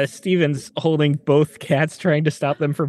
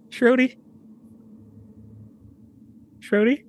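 higher, questioning call; calling a pet; 9 semitones higher than usual. Acted. Owner calling the cat after the fight, high questioning call. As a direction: call for your pet in the dark.

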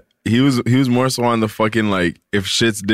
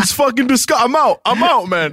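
disgusted outburst; 12 semitones higher than usual. Real, not acted. Friend grossed out at the table, high disgusted yelp. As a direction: recoil and bail out, high-pitched.

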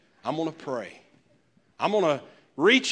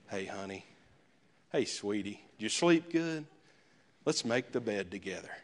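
much softer, lower, tender morning greeting to a spouse; newlywed sweetness; 7 semitones lower, 10 dB quieter than usual. Retold or imitated. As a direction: coo it like you just woke beside your new spouse.

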